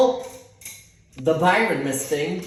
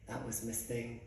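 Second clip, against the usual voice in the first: low, hushed retold answer; quiet reveal; 6 semitones lower, 15 dB quieter than usual. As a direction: say it small and under, very softly. Quietly telling mom who that was.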